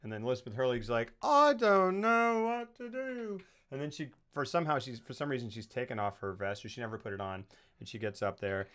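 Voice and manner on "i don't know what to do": high, drawn-out helpless whine; exaggerated high, slow cry of helplessness; mock helpless wail. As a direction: wail it slowly like a hammy damsel. Acting out panicked film character.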